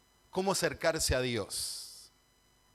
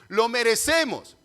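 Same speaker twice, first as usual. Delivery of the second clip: louder, higher emphatic declaration; afirmación tajante; 4 semitones higher, 7 dB louder than usual. Real, not acted. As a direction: drive the hard truth home loudly. Predicador recalca una verdad dura. (afirmación fuerte y enfática)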